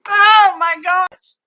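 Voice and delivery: high, loud and drawn-out "Oh my gosh"; shocked exclamation. Real, not acted. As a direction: stretch it out in shock. Reacting to a scary birth story.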